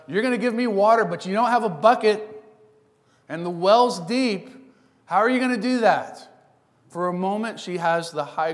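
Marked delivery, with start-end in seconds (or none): 0.1-6.3 higher, louder, teasing disbelief; snarky. Retold or imitated. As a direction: smirk through the whole jab. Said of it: "a little snarky dimple there on the side".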